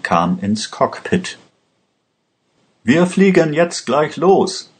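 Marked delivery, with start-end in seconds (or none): 2.8-4.7 raised, higher call to take off now; said loudly. A narrator's reading. Aladdin urging the pilot to leave, loud and high. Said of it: "sagte er laut".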